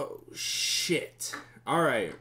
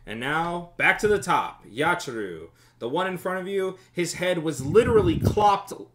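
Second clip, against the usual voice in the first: higher, punchy game master narration; dramatic battle narration; 6 semitones higher, 8 dB louder than usual. A narrator's reading. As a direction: narrate the killing blow with relish, voice raised.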